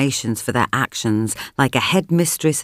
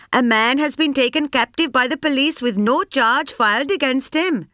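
public address; 11 semitones higher than usual. A narrator's reading. Addressing the press.

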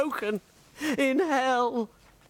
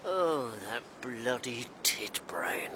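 hushed, slow whispered aside; whispered insult; 11 semitones lower, 7 dB quieter than usual. Retold or imitated. Quoting Jacques's muttered insult, whispered. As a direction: breathe the insult out under your breath. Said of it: "Jacques said".

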